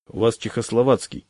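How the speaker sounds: ordinary delivery, nothing marked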